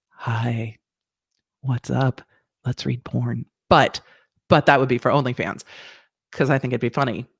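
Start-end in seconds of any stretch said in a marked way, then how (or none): 0.1-3.5 lower, softer sultry voice; a lightly voiced sultry tease; sexy voice. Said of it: "in my sexy [name] voice".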